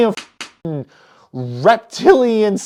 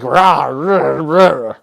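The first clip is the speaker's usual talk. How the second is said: low, growled mock monster roar; 5 semitones lower, much slower than usual. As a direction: growl it like a beast tearing into a ship.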